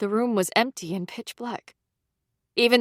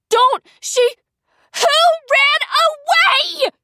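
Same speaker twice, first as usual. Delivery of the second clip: high, loud, slowing scream; 7 dB louder, slower than usual. A narrator's reading. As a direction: scream it out confused. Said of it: "Candace screamed".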